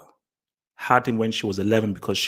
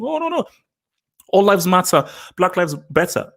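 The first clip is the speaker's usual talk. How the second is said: mocking Terry Crews; 6 semitones higher than usual. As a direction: sneer it out in a high mocking voice. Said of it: "like a Terry Crews, saying".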